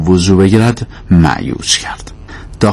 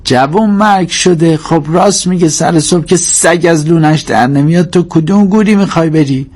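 high-pitched cursing mother's scolding; exasperated mother telling off her boy; 10 semitones higher than usual. Acted. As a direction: tear into the son in a raised pitch. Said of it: "به کریم تشر زد".

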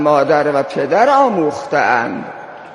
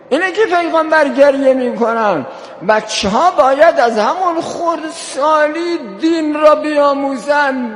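high-pitched, insistent sermon delivery; impassioned preaching; 10 semitones higher than usual. Real, not acted. Preacher builds to impassioned exhortation. (موعظهٔ پرشور با صدای بالا)

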